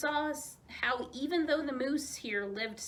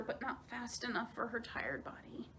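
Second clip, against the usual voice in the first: tired whisper; 3 semitones higher, 8 dB quieter than usual. A narrator's reading. Narration of tired swimmer.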